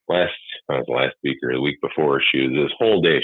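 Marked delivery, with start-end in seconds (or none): none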